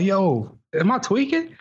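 high-pitched incredulous question; retold bafflement. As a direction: ask it in mock disbelief, voice climbing. Retelling baffled question to companion.

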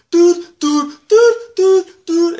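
very high, loud repeated calling; shouted interruption. Retold or imitated. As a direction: yell into the phone over and over. Sibling interrupting phone call.

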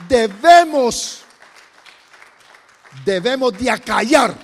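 preaching at full force. Real, not acted. Pastor urging the congregation to silence fear, loud forceful preaching. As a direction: hammer out debemos de acallar.

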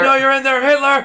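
very high, loud hammed-up yell; mock exorcist shout. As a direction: call out the demon at full mock pitch. Mock exorcism, calling to the demon.

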